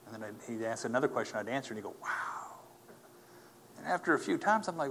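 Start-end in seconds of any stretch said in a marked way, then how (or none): 2.0-2.6 breathy, unvoiced "wow" of amazement; awed wow. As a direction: let it out as a stunned, whispered breath. Retells medic's repeated amazement.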